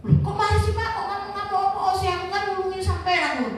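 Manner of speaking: mock indignant "why are you angry"; a hammed-up startled reply; surprised protest. Retelling her protest to father.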